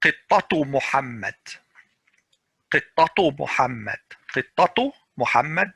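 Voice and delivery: qittatu Muhammad with a clear tu linking on; ta pronounced when you continue; connected form. Shown as a demonstration. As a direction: say qittatu, then glide into Muhammad. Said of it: "For example".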